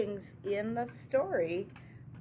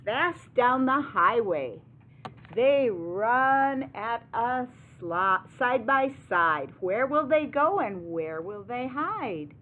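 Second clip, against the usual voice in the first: higher, louder read-aloud; excited picture-book narration; dramatic story reading; 4 semitones higher, 9 dB louder, slower than usual. A narrator's reading. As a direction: drive the chase along for young listeners.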